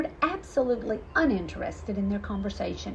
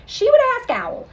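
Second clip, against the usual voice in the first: kitten voice; 13 semitones higher, 9 dB louder than usual. A narrator's reading. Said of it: "She wondered".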